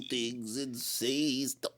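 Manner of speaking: slow, low, creepy drawl; spooky low voice, dragged out. Pretend. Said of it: "I had to come out with my creepy voice".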